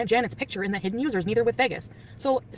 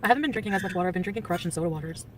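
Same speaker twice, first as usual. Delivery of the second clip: ordinary talk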